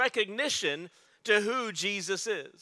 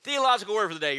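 louder, pumped-up hype; energetic announcement; 5 dB louder, much faster than usual. Shown as a demonstration. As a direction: sell it to the crowd with energy. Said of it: "Wow, that was really disheartening".

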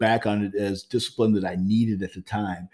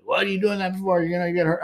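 imitating an angry father, pitched higher; mock scolding from a mad dad; 9 semitones higher than usual. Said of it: "he was mad. He was angry".

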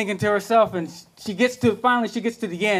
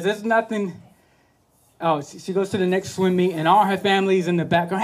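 ordinary talk

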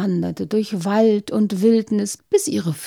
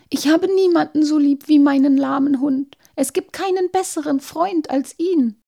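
pleading princess; 7 semitones higher than usual. Acted. Princess refuses the wolf.

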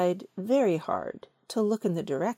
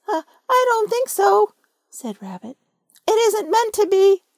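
much higher, louder put-on voice; disguised Rabbit voice; 11 semitones higher, 9 dB louder than usual. Rabbit disguises his voice to fool Pooh, high and loud. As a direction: squeak it up so Pooh won't know you. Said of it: "in a different sort of voice this time".

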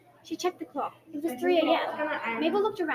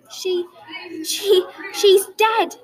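bursting out; 5 semitones higher, 5 dB louder, much slower than usual. A narrator's reading. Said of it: "he burst out".

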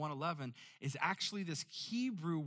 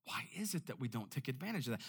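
usual speaking voice

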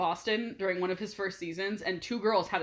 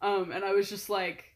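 normal talking voice

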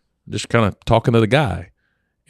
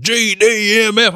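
slow, high mock swearing; cursing guy; 13 semitones higher, 3 dB louder, slower than usual. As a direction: drag out the curse like the angry guy. Guy cursing retold again.

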